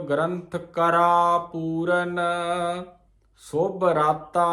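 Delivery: slow, with a pause after Puran before ਸੁਭ ਰਾਤਾ. Shown as a demonstration. Teacher showing the sangat the pause after Puran. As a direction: rest on Puran, then ਸੁਭ ਰਾਤਾ slowly. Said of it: "ਇਹਦਾ ਵਿਸਰਾਮ ਧਿਆਨ ਦਿਓ".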